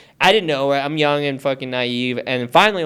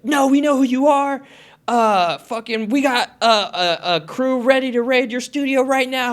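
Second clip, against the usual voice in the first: higher-pitched imitation of a cop, stumbling mid-threat; threatening cop; 8 semitones higher than usual. Storyteller as the cop threatening a raid, mock confrontational. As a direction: play the officer insisting and warning.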